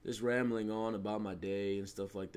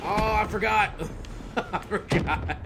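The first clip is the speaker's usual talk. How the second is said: high laughing, drawn out slowly; 8 semitones higher, 6 dB louder, slower than usual. Real, not acted. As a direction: giggle through it. Laughing at own mistake.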